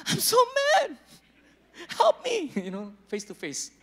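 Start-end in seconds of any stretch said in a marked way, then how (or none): 0.1-2.5 put-on much higher, pleading girlfriend voice; high mock woman. Pastor mimicking a woman venting face to face, high and pleading. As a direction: squeal it like a woman venting to her friend. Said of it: "women, like".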